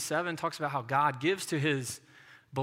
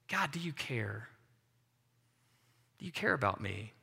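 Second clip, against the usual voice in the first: hushed plea to God; 5 semitones lower, 7 dB quieter than usual. Retold or imitated.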